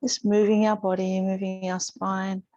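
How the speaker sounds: calm, unhurried instructor voice with long pauses; even, measured cues, leaving space to breathe; guided yoga meditation. Real, not acted. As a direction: gently guide a class through breath and movement. Leads breathing and gentle yoga.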